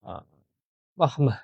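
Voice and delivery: ordinary talk, nothing marked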